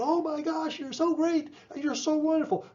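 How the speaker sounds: hammed-up over-the-top delight at a kid; gushing overboard praise. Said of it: "you make a big deal out of it".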